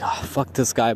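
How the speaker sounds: low, soft mock grumble; imitated dismissive grumble. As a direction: grumble it under your breath as the annoyed waiter. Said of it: "he was kinda like".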